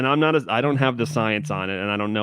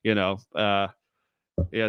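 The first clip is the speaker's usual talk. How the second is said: ordinary delivery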